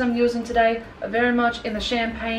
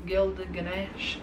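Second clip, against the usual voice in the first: savoured softly; 4 semitones lower, 9 dB quieter, much slower than usual. Shown as a demonstration. Savouring a shade name.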